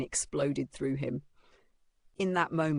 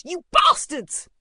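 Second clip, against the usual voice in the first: high, drawn-out furious shout; loud, furious; 13 semitones higher, 11 dB louder, slower than usual. A narrator's reading. Mother rushes to her son, shouting furiously. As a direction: roar at them in a mother's rage. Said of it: "her voice rang out, loud and furious".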